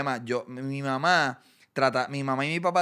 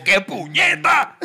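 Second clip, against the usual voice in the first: high, loud, slow scolding burst; hammed-up mom outburst; mock mom yell; 12 semitones higher, 10 dB louder, slower than usual. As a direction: explode like an angry mom.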